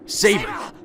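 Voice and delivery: high, urgent shout of her name; calling out. Acted. Boy reaching the rooftop shouts to the kneeling Saber, alarmed. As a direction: cry out to her across the rooftop.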